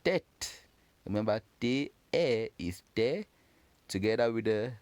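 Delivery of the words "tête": tête said high and clear, one syllable, final e silent; French tête. Shown as a demonstration. Teacher revealing the word tête to students, high and bright. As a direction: lift and model tête cleanly. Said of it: "this word pronounced as".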